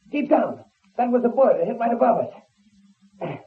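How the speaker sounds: high-pitched cries under gunfire; alarmed, far above normal pitch; urgent warning. Acted. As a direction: shout orders to flee a shooting. Under gunfire, ordering her to ride.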